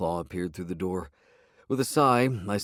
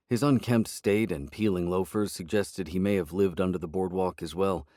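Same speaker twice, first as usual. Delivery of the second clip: ordinary delivery, nothing marked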